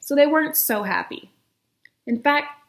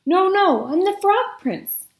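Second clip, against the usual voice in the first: eager insisting; 8 semitones higher, 5 dB louder than usual. Acted. Prince insists.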